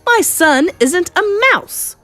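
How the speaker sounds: high, loud, drawn-out denial; outraged shout. Acted. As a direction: shout it like an affronted father. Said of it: "shouted Mister Jenkins".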